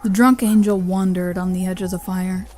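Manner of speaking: plain reading voice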